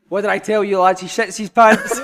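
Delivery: loud gloating I told you so. Retold or imitated. Manager gloating to the lads, loud and triumphant.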